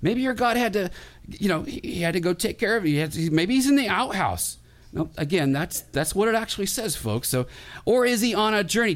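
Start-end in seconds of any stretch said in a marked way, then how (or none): 0.0-4.6 higher, teasing jibe; playful mocking. Elijah teasing the prophets about their god, higher and mocking. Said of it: "Elijah's saying". 7.8-8.9 higher, louder teasing ask; mocking question. Elijah mocking the prophets' absent god, loud teasing question. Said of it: "he's having some fun".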